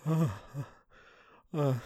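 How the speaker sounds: ordinary talk, nothing marked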